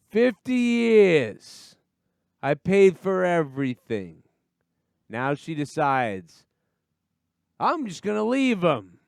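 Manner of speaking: put-on high, slow, whiny drunk lament; bitter drunk. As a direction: wallow in it like a jilted drunk. Imitating a bitter divorced drunk.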